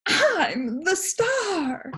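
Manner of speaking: very high, slow shriek. Acted. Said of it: "He shrieked".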